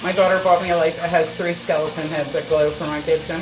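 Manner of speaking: normal talking voice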